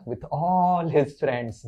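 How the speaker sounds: ordinary delivery, nothing marked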